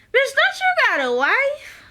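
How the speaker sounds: high-pitched mock outrage; incredulous outburst. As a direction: call her out in squeaky disbelief. Mock outburst at show character.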